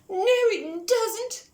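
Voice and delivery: blurted, tearful. Acted. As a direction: blurt it out near tears. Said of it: "blurted Pig".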